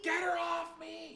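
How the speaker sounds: high, strained shout for help; panicked yell. Acted. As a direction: yell out to the knights to help you. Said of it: "yelling at the knights".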